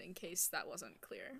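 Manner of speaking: dry whispered aside; slow, hushed dry aside; deadpan whisper. Real, not acted.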